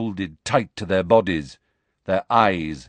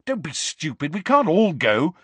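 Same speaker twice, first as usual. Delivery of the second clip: rising into a louder snap; angry; 8 semitones higher, 3 dB louder than usual. A narrator's reading. Harry to his friends, angrily refusing. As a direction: cut them off angrily. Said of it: "said Harry angrily".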